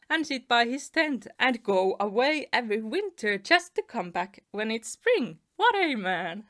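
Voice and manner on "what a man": plain reading voice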